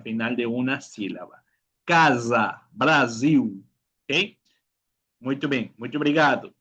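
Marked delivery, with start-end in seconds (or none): 1.8-3.6 "Casa, Brasil" in syllables, ca-za, bra-ziu. Shown as a demonstration. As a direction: buzz the S and end "Brasil" in U. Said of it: "recuerda que la L se pronuncia como U". ("Casa, Brasil" con S sonora y L como U)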